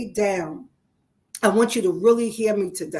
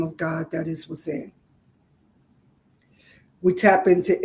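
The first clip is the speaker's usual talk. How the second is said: guided meditation; 3 semitones lower than usual. Acted. Livestream host guiding viewers into gratitude, soothing meditation voice. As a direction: ease the live audience into calm, one body part at a time.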